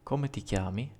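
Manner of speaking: normal talking voice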